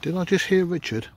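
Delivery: startled question. Real, not acted. Ghost hunter suddenly hearing a name, startled. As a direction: jump at a voice you think you heard.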